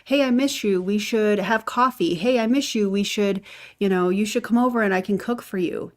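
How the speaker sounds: hammed-up friendly sales pitch; mock recruiting pitch. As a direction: lay the sweetness on thick to recruit a friend. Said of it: "is Lisa's recruiting pitch".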